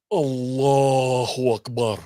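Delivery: slow, drawn-out Allahu Akbar in a put-on Middle Eastern accent; mock Middle Eastern accent. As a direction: lightly imitate her sudden accent. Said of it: "now she's got the Middle Eastern accent".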